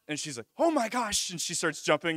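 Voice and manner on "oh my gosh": high, loud amazed exclamation; thrilled high-pitched outburst; excited. Retold or imitated. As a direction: imitate someone bursting with excitement. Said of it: "she's getting all excited".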